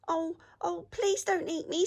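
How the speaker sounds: high, mock-frightened rabbit plea; pleading rabbit. A narrator's reading. Rabbit begging the troll not to eat him, high pleading. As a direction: beg in a squeaky rabbit voice.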